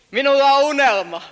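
loud, held dream line; proclaimed quote. Retold or imitated. Preacher closing with King's line, loud proclamation.

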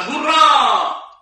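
high, long celebrating hurrah; team's cheer. Acted. Team celebrates the repeated goal, high drawn-out cheer. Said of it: "Tämän pienen pojan joukkue huutaa".

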